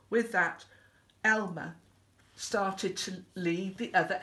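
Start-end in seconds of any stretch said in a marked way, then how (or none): none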